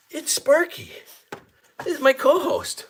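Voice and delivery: very high, thrilled announcement; excited introduction. Real, not acted. Host presenting his dog co-host, excited introduction. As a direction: show him off in a high voice.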